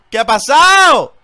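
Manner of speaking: loud, slow, stunned questions; shocked disbelief. Real, not acted. Streamer shouting in disbelief at his fallen Talonflame. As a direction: demand to know what just happened. Teammate suddenly dies.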